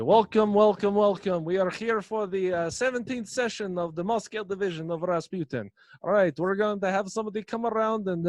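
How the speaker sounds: loud, high hammed-up Russian cult leader welcoming his followers; Russian accent. Cult leader greeting the Moscow division, loud hammed-up Russian accent. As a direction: boom out a welcome in a thick fake Russian accent. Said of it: "I'm losing my Russian accent".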